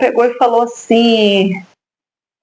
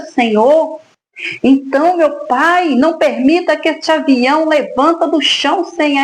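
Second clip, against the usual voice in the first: pleading prayer, pitched up and pressing; retold fervent prayer; 4 semitones higher than usual. Mother reliving her airport prayer for her daughter, pleading prayer. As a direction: plead with God in prayer, raised and urgent.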